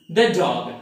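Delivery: short thuh in "the dog"; "the" without a long ee before "dog"; weak the before consonant. Shown as a demonstration. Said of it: "this word is pronounced".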